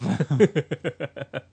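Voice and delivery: high bursting giggle; loud giggle. Real, not acted. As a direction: giggle right into the mic. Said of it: "you giggle and spike over the top".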